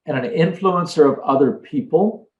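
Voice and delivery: usual speaking voice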